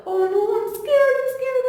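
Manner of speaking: high, hurried mock-scared voice; gasp, then squeal it in pretend fear; acted fright. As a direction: play a frightened child for young listeners. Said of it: "He looks scared".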